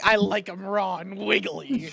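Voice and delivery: slow, creepy Gollum rasp. Retold or imitated. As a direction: hiss it like Gollum. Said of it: "he fucking is like".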